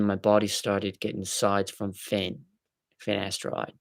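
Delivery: reading aloud in a put-on Aussie accent; chummy mock-Australian voice. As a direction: pretend to be an Australian reading a message. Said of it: "That's how an Australian would say finasteride".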